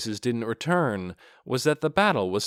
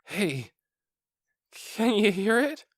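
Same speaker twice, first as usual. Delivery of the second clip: trembling guard; 10 semitones higher than usual. Acted. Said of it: "his voice trembling".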